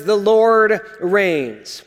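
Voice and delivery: slow, weighty, every word landed; headline proclamation. Acted. Preacher announces the psalm's opening words, slow and weighty. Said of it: "This really is the headline".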